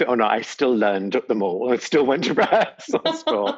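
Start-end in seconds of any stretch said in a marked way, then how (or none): 1.7-3.6 real laughter breaking into the words; laughing. Real, not acted. Judge admitting she still went to rehearsals, laughing. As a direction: laugh through the end of the sentence. Laughs at own over-preparation.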